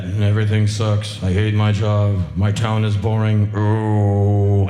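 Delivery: low, loud, dragging complaint; mock whiner complaining about life; mopey whiner. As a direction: moan it like a bored sulker. Mocking jaded whiners.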